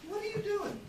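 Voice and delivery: "doing" said as doin', without the final g; Southern dropped g. Shown as a demonstration. Texan teacher greeting a pupil, g dropped in "doing". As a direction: relax the ending of "doing" to doin'. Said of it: "say this a little bit differently".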